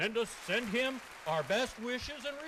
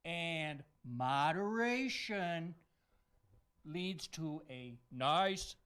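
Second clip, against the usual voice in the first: half speed, sinking lower; slow, measured advice; 5 semitones lower, much slower than usual. Pretend. Santa urges a safe holiday, slow and measured. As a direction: space out each phrase and let it drop.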